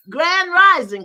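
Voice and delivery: high, loud shout of welcome; big rising hello to everyone; calling out a greeting. Real, not acted. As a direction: call it out to the whole room. Greeting the live audience.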